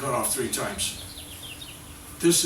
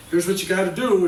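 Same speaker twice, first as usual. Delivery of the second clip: lecturing know-it-all; 5 semitones higher, 7 dB louder than usual. Retold or imitated. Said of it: "going to an A A meeting ... and saying".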